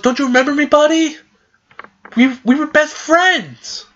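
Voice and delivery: high-pitched hammed-up plea; pleading. Axel pleads with his best friend Roxas, pleading. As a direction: plead like a hurt old friend.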